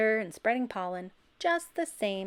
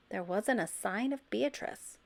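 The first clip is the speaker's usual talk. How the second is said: usual speaking voice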